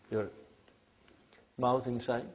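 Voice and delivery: ordinary delivery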